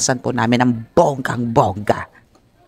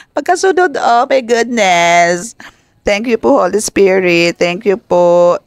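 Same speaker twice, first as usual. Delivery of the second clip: much higher, louder, slow oh my goodness; excited gratitude; 7 semitones higher, 4 dB louder, slower than usual. Real, not acted. Tarot reader thanking the Holy Spirit, excited and high.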